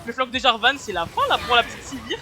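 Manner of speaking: loud, rising play-by-play call; esports caster. Acted. Casts the teamfight live.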